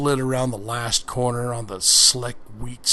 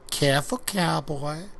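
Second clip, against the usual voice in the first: flirty warning; 4 semitones higher than usual. Acted. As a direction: tease him with a playful caution. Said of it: "she said".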